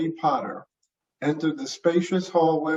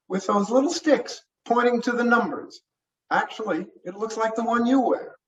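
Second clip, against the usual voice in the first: higher, eager and fast; young deputy voice; 7 semitones higher than usual. Acted. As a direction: play the keen rookie deputy. Deputy continues.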